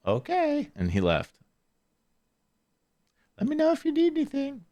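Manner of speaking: high, light put-on neighbor voice; imitating the neighbor. Neighbor tells the sick kid to call if needed, high mock voice.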